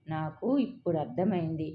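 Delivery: plain reading voice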